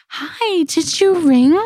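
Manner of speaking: high, sweet and gentle nurse voice; sweet angel nurse. Retold or imitated. Nurse greets the new mother sweetly, high and sweet. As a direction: coo it like a sugary nurse. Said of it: "this, like, angel baby nurse".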